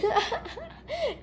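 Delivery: a high, breathy giggle demonstrating how she laughed; showing her laugh. Shown as a demonstration. Showing a friend how she laughed in the vision, high giggle. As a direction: giggle the way you laughed back then. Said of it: "Eu tava tipo assim".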